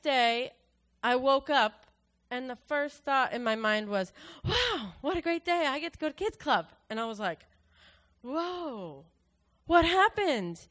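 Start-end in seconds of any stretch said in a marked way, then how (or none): 4.4-6.7 high, bubbly burst of delight; excited waking thought. Retold or imitated. First thought on waking up the next day, excited. 8.2-10.7 marvel, then burst out with the question; amazed. Retold or imitated. Recalling the sudden change in her mind, amazed.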